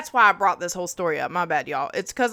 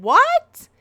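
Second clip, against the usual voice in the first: shocked, much higher and louder "What?"; stunned what; 9 dB louder than usual. Retold or imitated. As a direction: yelp it out in disbelief, high and loud. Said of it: "And I said".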